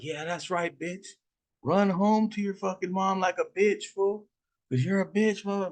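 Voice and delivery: high sing-song jeer; mocking taunt. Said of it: "Oscar's like".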